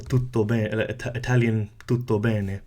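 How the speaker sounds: tutto bene with a soft Italian T. Shown as a demonstration. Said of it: "like in the Italian".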